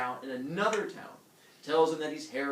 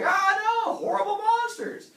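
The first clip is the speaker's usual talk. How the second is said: loud, high comic wail; horrified shriek; 10 dB louder, slower than usual. Retold or imitated. Heracles screaming along with villagers, high loud horror. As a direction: scream it in hammed-up terror.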